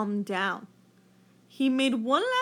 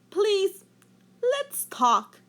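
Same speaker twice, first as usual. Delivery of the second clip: high, louder plea; Rainbow Fish pleading; 9 semitones higher, 5 dB louder, much slower than usual. A narrator's reading. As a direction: ask for peace, high and earnest. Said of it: "said Rainbow Fish".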